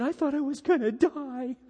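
high-pitched, mock-weepy little boy; mock tearful child. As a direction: imitate a frightened son close to tears. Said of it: "he's got tears in his eyes".

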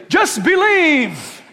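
drawn-out, high, loud mocking "just believe"; mocking slogan. Preacher mocking shallow faith advice, slow and loud. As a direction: sneer the slogan slowly, with a laugh. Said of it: "When people say".

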